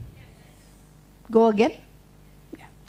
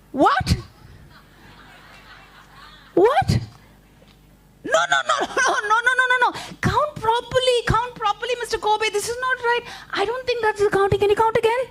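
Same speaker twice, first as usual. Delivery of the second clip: shocked protest; 10 semitones higher than usual. Acted. Spider protests wrong count.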